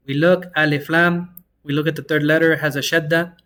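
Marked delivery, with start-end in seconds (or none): none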